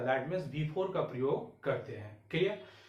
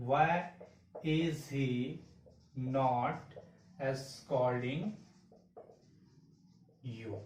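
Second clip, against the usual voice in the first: slow dictation; 3 semitones lower, much slower than usual. Shown as a demonstration. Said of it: "teacher dictates the sentence".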